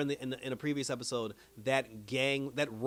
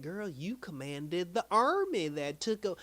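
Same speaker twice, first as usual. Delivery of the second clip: Miss Minutes voice; 6 semitones higher, slower than usual. Retold or imitated. Voicing Miss Minutes to Renslayer.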